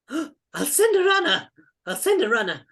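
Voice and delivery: put-on high, flustered old fellow's voice; excitable little old man. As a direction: fuss aloud in character, promising help. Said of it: "turns to him and says".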